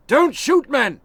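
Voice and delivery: high, loud order; commanding shout. A narrator's reading. General Monroe ordering his men, loud command. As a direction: stop the soldiers firing.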